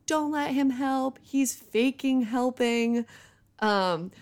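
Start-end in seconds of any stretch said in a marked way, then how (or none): 0.1-3.0 slow, higher mock protest; hammed-up losing it, words stretched; retold drunken outburst. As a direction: drag out the accusation, imitating drunken indignation. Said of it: "I was losing it. I was like".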